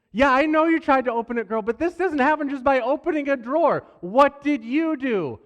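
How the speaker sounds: a parent losing patience with the excuses; exasperated dad. Retold or imitated.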